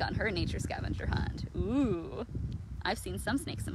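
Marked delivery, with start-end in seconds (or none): none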